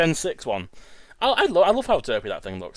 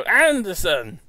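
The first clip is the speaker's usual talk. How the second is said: higher, mock-exclaimed name; playful parroting, pitched up; imitating a friend's exclamation; 5 semitones higher, 4 dB louder, much slower than usual. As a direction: mimic your friend's botched accent attempt. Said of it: "I just heard you go".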